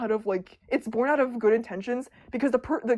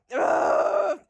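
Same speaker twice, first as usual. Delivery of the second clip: frustrated groan; 3 semitones higher, 5 dB louder than usual. Real, not acted.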